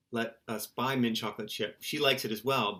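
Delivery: normal talking voice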